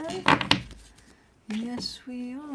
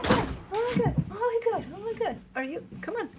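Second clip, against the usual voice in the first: excited puppy talk; 13 semitones higher, faster than usual. Real, not acted. Greeting excited dog.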